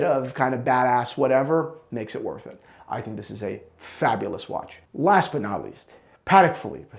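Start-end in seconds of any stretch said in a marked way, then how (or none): 4.9-7.0 breathless from talking; out of breath. Real, not acted. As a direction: run out of air on the words. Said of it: "See, I'm out of breath".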